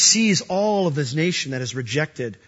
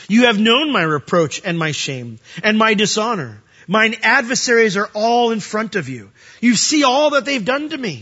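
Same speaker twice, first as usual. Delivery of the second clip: rising, louder pleading read of the psalm; full-force preaching; 6 semitones higher, 5 dB louder than usual. Acted. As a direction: cry the verses out to the congregation.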